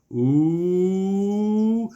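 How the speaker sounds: long high rising "ooh"; exaggerated oohing at fancy talk; mock-impressed ooh. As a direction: draw out a teasing, climbing ooh. Mocking the salesman's fancy term.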